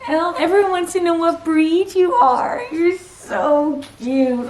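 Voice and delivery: high, sweet dog-talk voice. Real, not acted. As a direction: coo at the puppy. Talking to her dog.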